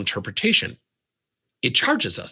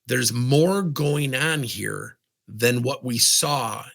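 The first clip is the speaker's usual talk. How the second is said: slow and deliberate; much slower than usual. Shown as a demonstration. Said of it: "What I said was".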